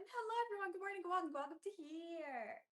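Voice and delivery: chirpy show-host welcome; big excited greeting. Real, not acted. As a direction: throw the greeting up high and eager. Second go at greeting viewers.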